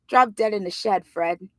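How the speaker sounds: usual speaking voice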